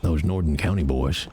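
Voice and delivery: deep, close warning; low menacing character. Acted. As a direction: lean in and warn in a low voice.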